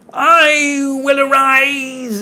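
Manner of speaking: very high, very slow, wailing poet's voice; old poet on record. Retold or imitated. A poet reciting on an old scratchy record, mock-solemn wail. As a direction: drag it out like a crackly old gramophone poet. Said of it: "you would hear a voice go".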